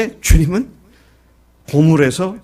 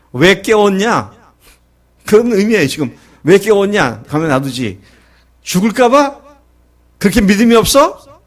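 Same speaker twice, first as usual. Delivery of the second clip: rebuking; 5 semitones higher, 5 dB louder than usual. Retold or imitated. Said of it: "그런 나무람의 말씀이에요".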